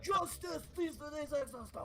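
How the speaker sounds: high-pitched imitation of a battle cry; hammed-up screaming zealot, very high; mock scream. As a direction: draw out a squealed mock war cry. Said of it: "Jack's screaming. He's like".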